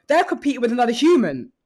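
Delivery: normal talking voice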